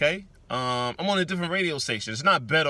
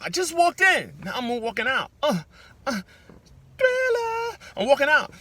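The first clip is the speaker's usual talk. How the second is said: high, sing-song [name] squeal; playful [name] bit; 12 semitones higher, 4 dB louder than usual. Pretend. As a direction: ham up the moonwalk exit.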